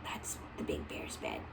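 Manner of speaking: soft whispered aside; hushed picture-book whisper. Real, not acted. Pointing out picture softly.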